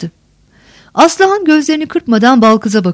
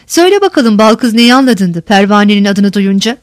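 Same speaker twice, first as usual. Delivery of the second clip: ordinary delivery, nothing marked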